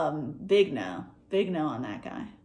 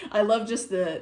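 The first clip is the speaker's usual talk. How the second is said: usual speaking voice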